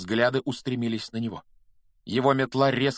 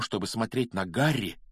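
ordinary delivery, nothing marked

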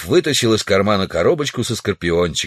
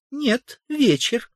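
high-pitched woman's voice; Тулия; 3 dB quieter, much slower than usual. A narrator's reading. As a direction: answer in a much higher female voice. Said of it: "женский голос произнёс". (высокий женский голос)

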